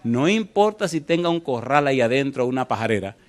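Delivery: ordinary talk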